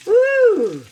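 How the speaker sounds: a high, loud woo; excited whoop. Real, not acted. Showing the frying meat, a high loud woo. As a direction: holler at the sizzle. Sausage and Spam cooking.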